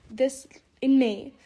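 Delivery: sore mouth from braces. Real, not acted. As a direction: push through a sore mouth. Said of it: "my mouth is really hurting".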